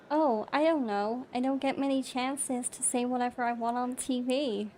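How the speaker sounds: put-on American accent, a little livelier; British speaker demonstrating an American accent. Shown as a demonstration. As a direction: do it in American, with a slight British twang. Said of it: "if I say it in American".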